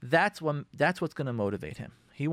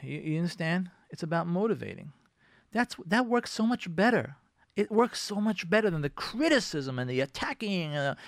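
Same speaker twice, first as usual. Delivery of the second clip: ordinary delivery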